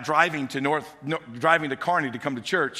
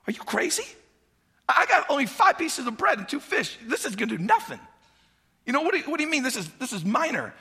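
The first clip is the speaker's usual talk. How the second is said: put-on higher, squeaky-ish disbelieving kid voice; incredulous little boy; 12 semitones higher, faster than usual. Little boy protesting to disciples about his lunch, incredulous. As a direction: play the boy who can't believe it.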